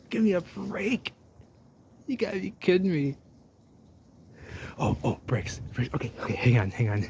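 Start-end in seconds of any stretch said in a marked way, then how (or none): 0.1-3.2 excited disbelief. Real, not acted. Explorer finding bricks in the canal, high excited disbelief. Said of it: "I'm getting excited here". 4.8-5.9 excited whisper. Real, not acted. Spots bricks.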